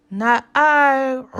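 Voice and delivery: loud, gruff bark of a reply; barking dog refusal. Acted. The noisy dog refusing the hen, barked.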